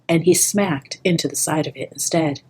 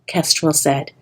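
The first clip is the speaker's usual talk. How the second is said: ordinary delivery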